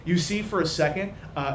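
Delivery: usual speaking voice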